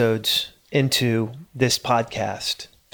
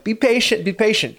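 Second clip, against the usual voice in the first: coaxing; 8 semitones higher than usual. Retold or imitated. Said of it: "I'm telling him".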